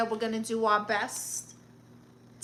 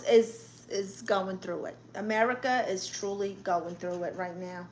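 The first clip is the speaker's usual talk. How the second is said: ordinary talk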